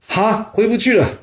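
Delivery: high-pitched startled disbelief; shocked. A narrator's reading. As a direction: react aghast, pitch jumping up. Master hears there is no return. (吃惊地提高音调)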